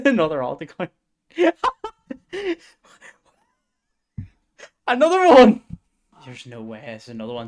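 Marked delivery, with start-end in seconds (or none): 1.4-2.6 high, disbelieving burst of laughter; a stunned, squealing laugh; shrieking laugh. Real, not acted. Streamer finding another alt account, shrieking laugh. 4.9-5.6 much higher and louder, stunned; incredulous shout. Real, not acted. Streamer spotting yet another alt account, incredulous shout.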